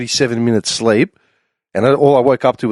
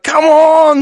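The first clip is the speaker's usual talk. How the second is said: high, loud teasing call; mock taunt; 5 dB louder than usual. Drunk teller teasing the passed-out Englishman in a loud mock call. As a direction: egg him on, high and loud.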